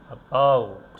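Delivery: weak "uh", loud strong "bout" in "about"; stress on second syllable. Shown as a demonstration. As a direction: punch the end of "about". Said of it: "উইক সিলেবলে উচ্চারণ করেছি". ("অ্যাবাউট"-এ "অ্যা" হালকা, "bout" জোরে)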